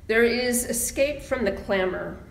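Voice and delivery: usual speaking voice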